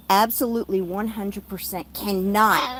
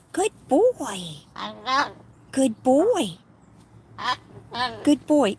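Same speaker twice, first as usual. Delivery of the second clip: praising pet; 4 semitones higher, slower than usual. Real, not acted. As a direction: praise the bird fondly. Praising the bird.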